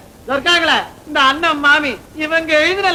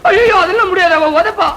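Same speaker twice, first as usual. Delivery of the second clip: louder, higher-pitched protest; alarmed refusal; 4 semitones higher, 4 dB louder than usual. Acted. Brother refusing a risky scheme, alarmed refusal. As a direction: recoil from the plan.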